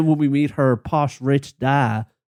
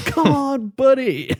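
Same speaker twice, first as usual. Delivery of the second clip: a higher mock voice of a guy off camera; 12 semitones higher, slower than usual. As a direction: imitate the off-camera guy, pitched up. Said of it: "some guy off camera going".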